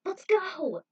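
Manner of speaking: high, loud call to run; Trudy urging her friend to flee, high and loud; urgent shout. A narrator's reading. Fleeing the cult.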